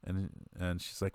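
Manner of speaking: usual speaking voice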